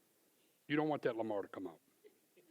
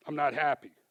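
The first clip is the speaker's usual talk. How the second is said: louder, higher complaint; retold boss grumbling, louder; mock displeased owner; 4 semitones higher, 10 dB louder than usual. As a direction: act the owner's annoyance. Owner complaining about the bill.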